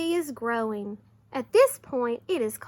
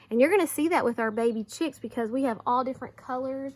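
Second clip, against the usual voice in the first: ordinary talk, nothing marked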